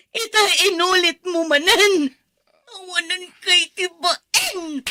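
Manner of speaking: 울음 섞인 높은 외침; 울부짖으며 천천히 내뱉기; crying shout. Acted. Wife breaks down, crying heard.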